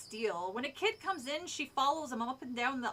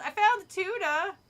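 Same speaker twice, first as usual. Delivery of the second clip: called out loud and higher; calling out; 8 semitones higher, 8 dB louder than usual. A narrator's reading. Boy calling back to the shopkeeper. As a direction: call across the store. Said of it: "I called".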